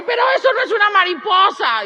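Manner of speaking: high, loud mock-furious protest; hammed-up shriek of maternal indignation; outraged mother. Mother protests again, more heated. (reclamo exagerado de mamá furiosa)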